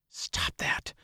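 unvoiced, hushed whisper; telling himself. A narrator's reading. Rider checks his own thoughts, whispering to himself. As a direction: whisper a sharp order to yourself. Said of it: "Perrin told himself".

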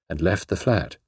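ordinary delivery

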